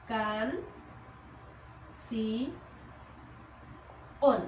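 can, si, on with long gaps between; separated syllables. Shown as a demonstration. Teacher splitting canción for students, syllable by syllable. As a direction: break it into three isolated syllables, can... si... on. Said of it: "Al momento de separarlas".

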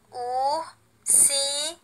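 "Ursinho" very slow and soft, split into pieces; syllable by syllable. Shown as a demonstration. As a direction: stretch each syllable of "Ursinho", with gaps between. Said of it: "bem devagar cada pedaço dessa palavra".

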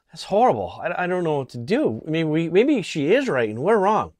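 mock anguished second thoughts; mock emotional. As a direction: imitate someone swayed by a tearful appeal. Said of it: "trying to use emotion".

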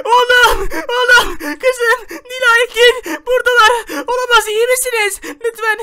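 high, drawn-out cries to loved ones; acted anguished calling. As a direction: call out to your children in panic. Father finds his hurt family. (çocuklarına feryatla seslenme)

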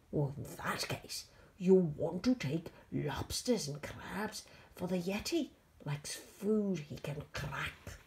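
put-on creature voice; big monster making a helpful offer; monster Nettie. Monster offers George lobsters and crabs, creature voice.

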